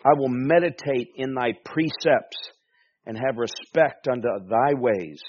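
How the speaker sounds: loud, measured reading of the psalm; scripture reading. Acted. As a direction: proclaim the verses to the congregation.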